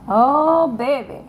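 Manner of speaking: loud, high delighted cry; thrilled at the card; excited. Real, not acted. To viewers, revealing the card, excited. Excited by drawing ancient forest.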